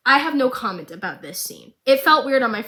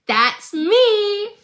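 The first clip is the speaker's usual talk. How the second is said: much higher and louder, bright and cheeky; gleeful reply; 10 semitones higher, 5 dB louder than usual. Retold or imitated. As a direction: spin round and sing it out. Jinx answers Silco.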